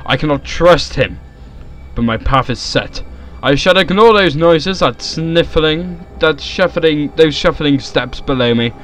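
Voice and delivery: loud, resolute story narration; dramatic reading. Acted. Reading the game's text aloud, in dramatic narration. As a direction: read it out with theatrical resolve.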